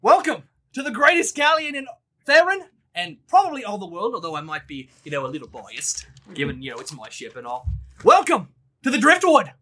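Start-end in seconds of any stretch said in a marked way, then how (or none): 0.0-4.2 boisterous captain. Acted. GM greeting the new crew as a brash high-voiced captain. 8.0-9.5 high, loud, boastful Aussie sea captain; boisterous captain. Acted. GM greeting the new crew as a brash high-voiced captain.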